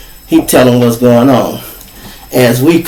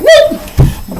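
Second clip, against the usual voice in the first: high squealing whoop; a sharp high whoop for the car swerving; imitated tire-squeal whoop; whoop sound. Imitating a speeding escape.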